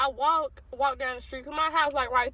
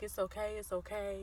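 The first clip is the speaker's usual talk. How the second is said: very soft, imitated brotherly reassurance; comforting; 10 dB quieter than usual. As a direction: soothe softly, like a consoling brother. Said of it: "'Cause my brother's understanding".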